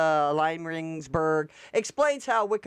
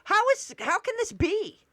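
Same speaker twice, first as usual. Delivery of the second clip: retold shock. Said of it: "I was like".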